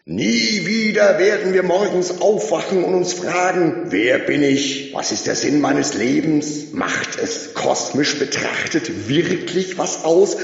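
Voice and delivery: high, loud rally speech fading at the end; loud high crowd speech; shouting orator. A narrator's reading. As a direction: preach salvation to the crowd. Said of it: "schrie der Mann".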